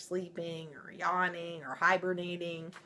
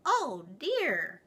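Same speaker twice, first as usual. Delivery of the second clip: put-on high small baby bear; wee little voice; 9 semitones higher, 4 dB louder than usual. Baby Bear upset about his chair, high and small. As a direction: sound tiny and dismayed. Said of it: "in his wee little voice".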